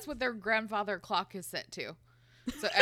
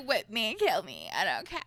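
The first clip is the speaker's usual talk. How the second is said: imitating Tessa; 4 dB louder than usual. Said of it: "She's like".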